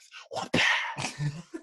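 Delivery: tongue-out whip crack sound. Acted. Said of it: "made the whip sound".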